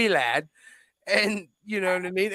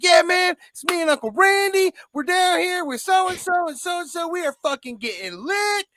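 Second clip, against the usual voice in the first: much higher, loud hyped voice; mock drunk party bro; 13 semitones higher, 3 dB louder than usual. Cousin on the phone inviting him down, high and hyped. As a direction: ham up the excited drunk cousin. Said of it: "He's like".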